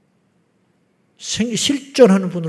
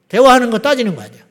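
ordinary talk, nothing marked

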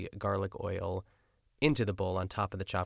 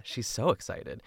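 normal talking voice

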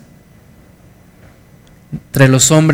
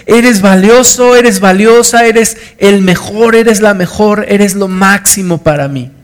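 preaching at full force; 6 semitones higher, 4 dB louder than usual. Real, not acted. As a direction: proclaim it to the whole church. Predicación a plena fuerza. (predicación fervorosa, aguda y fuerte)

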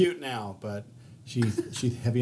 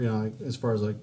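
ordinary talk, nothing marked

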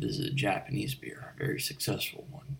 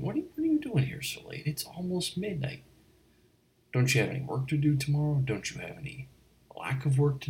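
much higher and fast, mock astonished; surprised, rushed; 6 semitones higher, faster than usual. Barkeep surprised to see a guest at midnight, high and fast. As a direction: rattle it off in a high-pitched fluster.

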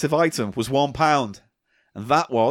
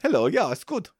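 put-on German voice, a little higher; German accent; 4 semitones higher, 3 dB quieter than usual. Host playing along for the guest, mock German accent. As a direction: answer in a comic German accent. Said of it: "Do some German accent for me".

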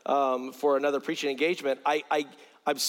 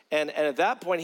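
ordinary talk

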